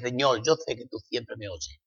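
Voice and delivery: soft, humble prayer to God; a hushed, trusting plea. Retold or imitated. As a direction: pray it quietly, surrendering your will. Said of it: "O le dices".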